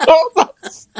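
ordinary talk

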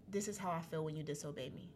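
soft, low imitation of God; gentle, hushed reproof; small voice. The Lord speaking to her afterwards, small and quiet. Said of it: "I heard that small voice again".

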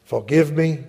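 slow, solemn prayer with long pauses; earnest prayer. Acted. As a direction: pray it aloud, phrase by phrase for the listeners to repeat. Leads prayer phrase by phrase.

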